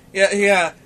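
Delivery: usual speaking voice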